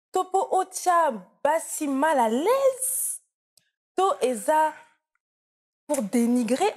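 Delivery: high, loud mock indignation in Lingala; excited Lingala outburst. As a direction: fire off a teasing rant in Lingala. Interviewer's heated teasing rant continues.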